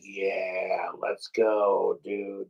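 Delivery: low, drawn-in quiet cheer; soft, deep-pitched mock hype to himself; hushed hype. Fan reacting to sandwich videos, hushed low hype.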